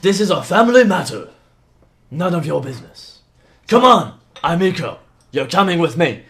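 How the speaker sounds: loud, commanding father; forceful in-character order; stern father. Acted. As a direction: lay down the law, loud and firm. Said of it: "as is yelling".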